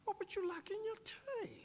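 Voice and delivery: very high-pitched British queen; high, dainty mock British royal voice; British accent. The Queen asking her guest about tea, mock British accent. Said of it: "in her British accent".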